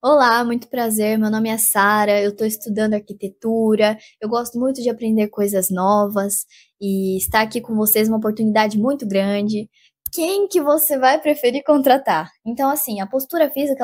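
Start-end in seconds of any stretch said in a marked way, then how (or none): 0.0-9.7 fluent, assured self-introduction; poised interview pitch at a steady pace; prepared, confident candidate. Shown as a demonstration. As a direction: introduce yourself readily, without hesitating. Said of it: "Com uma pessoa que já tá pronta, já tá ali falando".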